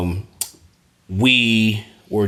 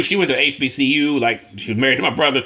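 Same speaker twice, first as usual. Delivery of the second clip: usual speaking voice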